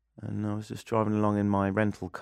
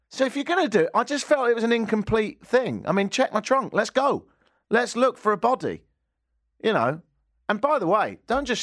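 worked-up rant; 10 semitones higher than usual. Real, not acted. Mock rant at the police.

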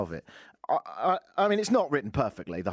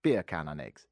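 "Beer can" run together as Jamaican "bacon", lower; lower-pitched mock Jamaican "bacon and eggs"; Jamaican accent; 8 semitones lower than usual. Shown as a demonstration. Host showing listeners his one Jamaican phrase, mock Jamaican accent. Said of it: "a little tip for you".

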